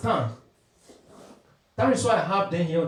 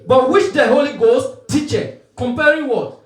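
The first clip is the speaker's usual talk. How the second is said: loud, ringing scripture quotation; emphatic preaching; 4 semitones higher, 9 dB louder than usual. Real, not acted. Preacher prompting the congregation with the verse, emphatic preaching. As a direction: punch the verse out and end on a challenge.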